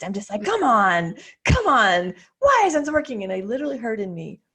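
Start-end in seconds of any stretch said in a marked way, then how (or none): 0.4-3.2 frustrated. Retold or imitated. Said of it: "I'm just getting all pissed".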